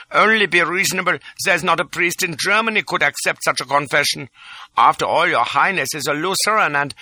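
high, begging little man; pleading cry. A narrator's reading. Little chap begging Lola, high and pleading. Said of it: "cries the little chap".

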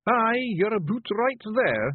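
high, rough little Scottish pictie, gently; a tiny Scots warrior breaking bad news; Feegle voice. Rob reassures, bedside manner.

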